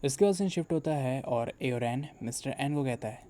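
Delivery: ordinary delivery, nothing marked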